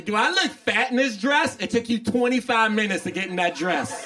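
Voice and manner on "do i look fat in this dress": mock the worried girlfriend asking; girlfriend's question. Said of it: "why you ask me".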